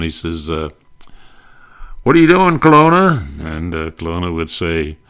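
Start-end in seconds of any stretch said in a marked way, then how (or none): 2.0-3.3 high, loud impersonation of Hope; Hope calling out on the phone; Bob Hope voice. Retold or imitated. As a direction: bark the question up high and loud. Retold Hope phone gag.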